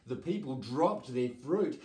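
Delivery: ordinary delivery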